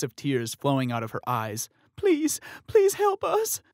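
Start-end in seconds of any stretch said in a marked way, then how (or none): 2.0-3.6 very high, slow, loud plea; tearful begging. Acted. Sister begging the crowd for help, tearful plea. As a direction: beg through tears. Sister crying over collapsed sibling.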